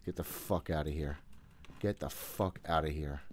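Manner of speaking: usual speaking voice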